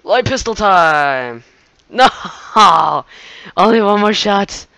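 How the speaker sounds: loud, high-pitched gamer yelling; worked-up, laughing holler; excited shouting. Real, not acted. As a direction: shout it over the game with bursts of laughter. Excited gameplay outburst, laughing.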